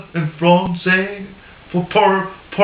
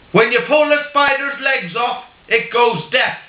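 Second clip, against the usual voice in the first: put-on higher, deadpan Irish accent for the punchline; 5 semitones higher than usual. Paddy Irishman explaining his finding to the interviewer, in a mock Irish accent. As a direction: deliver it like a proud Irishman sure of his science. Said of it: "I'll do it in an Irish accent".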